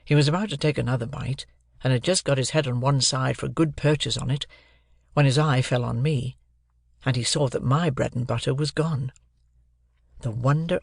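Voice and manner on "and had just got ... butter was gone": ordinary delivery, nothing marked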